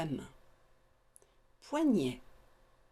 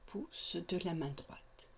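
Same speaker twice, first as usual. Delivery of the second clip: softly echoed, falling away; mental repetition; 7 dB quieter than usual. Shown as a demonstration. Guide showing students what to repeat mentally, softly echoed. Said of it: "Mentalement, répétez".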